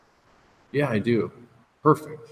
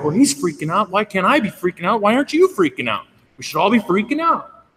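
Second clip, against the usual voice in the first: higher, louder, spiraling mock panic; mock freakout; 11 semitones higher, 6 dB louder than usual. Old self feeding off coworker's panic, mock frantic. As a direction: whip yourself into a hammed-up frenzy. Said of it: "It's like".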